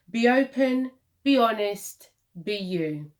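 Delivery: ordinary delivery, nothing marked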